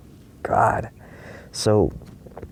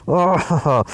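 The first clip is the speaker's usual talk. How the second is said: high pained oh; 9 semitones higher, 3 dB louder than usual. Retold or imitated. Reliving the hand injury.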